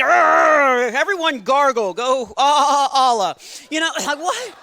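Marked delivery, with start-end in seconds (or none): 0.0-3.4 loud, hammed-up pirate-like growl; shouted parody of a toothpaste terrorist; mock terrorist. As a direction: bark orders like a cartoon villain. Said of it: "Tartar terrorists".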